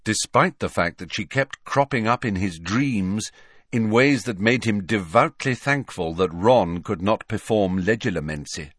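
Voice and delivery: ordinary delivery, nothing marked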